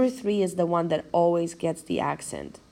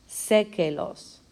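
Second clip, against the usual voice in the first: "Sé que los" with the first syllable Sé stressed; slower than usual. Shown as a demonstration. Teacher showing students where the accent falls, stress on Sé in "Sé que los". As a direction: lean on Sé in "Sé que los", then let "que los" follow lightly. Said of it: "we put the accent over the E".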